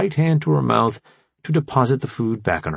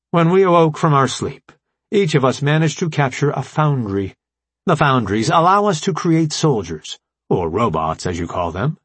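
formal AI commander, raised and emphatic; brief the allies in a higher, deliberate voice; Alpha Prime; 5 semitones higher than usual. Acted. Voices the AI leader explaining.

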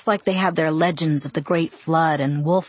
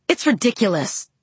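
spluttering; 3 semitones higher than usual. A narrator's reading. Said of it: "Charlie spluttered".

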